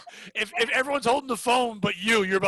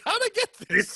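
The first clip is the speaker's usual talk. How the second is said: confused high voice; 12 semitones higher than usual. Acted. Acting a confused bar survivor.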